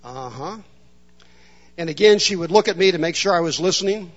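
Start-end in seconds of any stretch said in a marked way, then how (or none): 0.0-0.6 stoic mumble. Retold or imitated. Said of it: "stand stoically and say".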